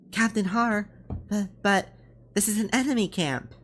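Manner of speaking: high-pitched, surprised character voice; startled young soldier. Acted. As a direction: react in disbelief to finding your captain here.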